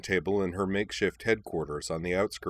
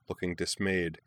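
plain reading voice

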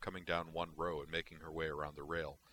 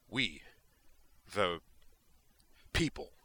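higher and louder, each word set apart by pauses; Kirk impression; 4 dB louder, slower than usual. Spock imitating Kirk's oratory to amuse his friends, intense and halting. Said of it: "pitched his voice a bit higher than normal, and said with intensity".

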